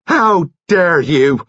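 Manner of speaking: slow, high, loud outraged cry; cried out. Acted. Nurse outraged at murder accusation, slow loud cry. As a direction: hurl it out in shock and fury. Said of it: "Nurse Kettle cried out".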